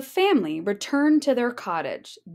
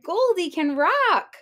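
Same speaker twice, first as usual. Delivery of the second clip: higher, louder cub cheer; excited cry; 7 semitones higher, 4 dB louder than usual. Acted. Baby Bear cheers for Goldie, high excited cry. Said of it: "And Baby cried".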